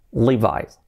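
Levi's as "LEE-vise"; English "li-VAIS" for Levi's; English pronunciation. Shown as a demonstration. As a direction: say Levi's with a final "eye" sound. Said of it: "pero no".

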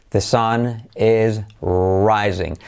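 "The sun is rising" slowly, word by word; slow model sentence. Shown as a demonstration. Teacher giving the example with the sun as subject, slow and careful.

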